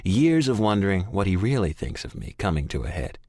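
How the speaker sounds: plain reading voice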